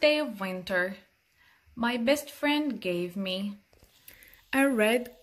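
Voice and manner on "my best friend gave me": usual speaking voice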